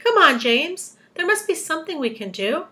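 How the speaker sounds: high-pitched storybook urging. A narrator's reading. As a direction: press him to find an answer. Centipede urging James on.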